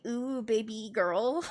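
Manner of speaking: put-on baby girl voice. Shown as a demonstration. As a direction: purr it out slowly. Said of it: "only voice I really have that I find really cringe".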